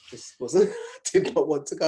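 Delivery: amused, laughing admission; laughing recount. Real, not acted. Guest recalling not wanting to go, laughing. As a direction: laugh through the words, emphatic.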